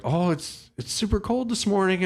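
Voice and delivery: high chipper driver impression; imitating the driver. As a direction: mimic an overly cheerful early-morning driver. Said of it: "And he's like".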